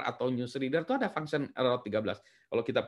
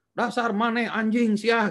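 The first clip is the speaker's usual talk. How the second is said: high, slow, hammed-up cursing; mock angry Sundanese insult; 10 semitones higher, 5 dB louder, slower than usual. Lecturer acting out an angry post, mock-furious Sundanese curse. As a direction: spit out a rude Sundanese insult in jest. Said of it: "ini ngomongnya kotor".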